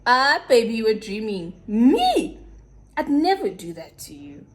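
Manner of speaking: hammed-up protest, bursting in loud then dropping; mock innocent denial. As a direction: imitate him playing the wronged innocent. Mimics boyfriend's exaggerated denial.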